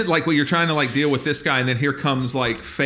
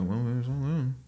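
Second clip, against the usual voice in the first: low, quiet grumble; muttered once they leave; 4 semitones lower, 7 dB quieter than usual. Retold or imitated. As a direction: mutter it so they can't hear. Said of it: "We wait until they leave".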